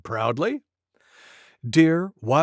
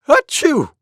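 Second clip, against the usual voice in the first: loud high sneeze; 11 semitones higher, 9 dB louder than usual. Acted. Monkey with a cold sneezes loudly. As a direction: let Monkey's sneeze burst out. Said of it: "went Monkey".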